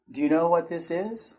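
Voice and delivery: ordinary talk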